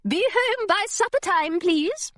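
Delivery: high-pitched, fussy governess voice; high, put-on governess tone; former governess. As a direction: remind a former charge primly. Former governess gives Hilary instructions.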